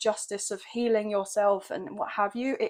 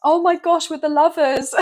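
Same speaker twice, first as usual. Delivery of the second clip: higher and louder, laughing in delight; delighted laughing outburst; 7 semitones higher, 9 dB louder than usual. Real, not acted. Reader laughing at the Lovers card, delighted laughing exclamation. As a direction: burst out laughing with joy at the card.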